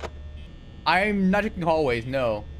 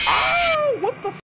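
real startled yelp, much higher and louder; jumpscare shriek; 3 dB louder, slower than usual. Real, not acted. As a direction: jolt out a shocked cry. Jumpscare in horror game.

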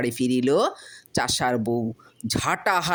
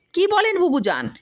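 insistent protest; 8 semitones higher than usual. Acted. Farmer insists.

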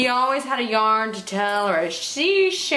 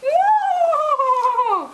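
high loud spooky woo; ghostly woo; 5 dB louder than usual. Acted. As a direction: howl like a ghost.

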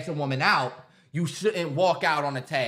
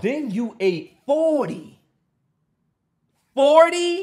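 the second Forty? much higher and louder; outraged disbelief; 7 semitones higher, 5 dB louder, much slower than usual. Real, not acted. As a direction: explode on the repeated Forty?. Outraged retelling of the oyster count.

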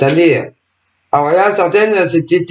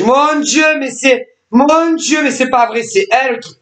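pitched up, imitating shocked people; mock shocked gasp; 9 semitones higher than usual. Vlogger imitates viewers shocked at the names, mock dismay. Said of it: "Ils ont dit".

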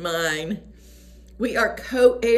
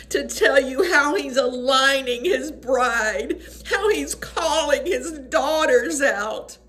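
higher, tearful preaching voice; overwhelmed with emotion; 7 semitones higher than usual. Real, not acted. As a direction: testify through real tears, urgent and fervent. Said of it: "I just get overwhelmed".

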